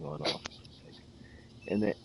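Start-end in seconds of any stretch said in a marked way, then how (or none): 0.2-1.0 soft unvoiced exasperated whisper; whispered aside. The clerk reacting to the customer, in a soft exasperated whisper. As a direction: breathe it out under your breath. His muttered reaction in story.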